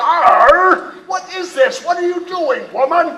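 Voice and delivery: loud, high pirate outburst; stagey fake archaic declaiming. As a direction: roar it like a startled captain. Captain is attacked in scene.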